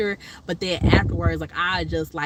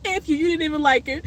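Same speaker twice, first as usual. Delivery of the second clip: laughing mock callout; 8 semitones higher, faster than usual. Imagined funeral callout, laughing.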